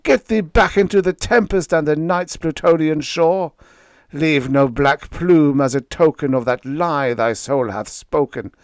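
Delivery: high and loud, driving the bird away; loud high-pitched banishment; shrieking command. Acted. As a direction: order the raven out in a rising fury. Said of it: "I shrieked".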